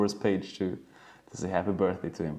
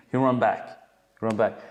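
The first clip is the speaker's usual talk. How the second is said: normal talking voice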